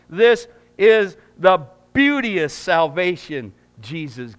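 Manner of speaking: loud, high preaching cadence. Acted. Pastor preaching doctrine to the church, loud and high. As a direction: preach it out to the congregation with full force.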